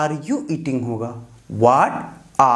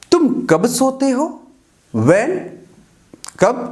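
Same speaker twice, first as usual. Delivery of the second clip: usual speaking voice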